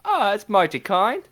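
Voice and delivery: high chirpy Cockney street urchin; old london cockney. Acted. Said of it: "my best pauper".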